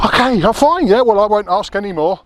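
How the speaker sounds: a startled, awkward climb-down; flustered surprise. Retold or imitated. Retold flustered reaction to joke.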